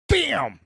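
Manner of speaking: high, punched-out crash word; sudden impact bam. Retold or imitated. Husband telling of hitting the dip, sudden loud bam.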